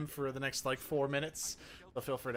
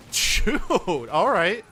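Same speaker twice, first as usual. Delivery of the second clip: frustrated outburst; 5 semitones higher, 11 dB louder, much slower than usual. Real, not acted. Enemy surprises him mid-run.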